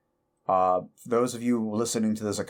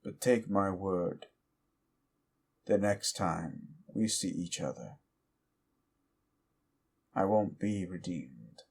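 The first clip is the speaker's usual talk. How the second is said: menacing demon threat; 4 semitones lower, 7 dB quieter than usual. Acted. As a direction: drop into a quiet, sinister vow. Demon villain's quiet closing threat.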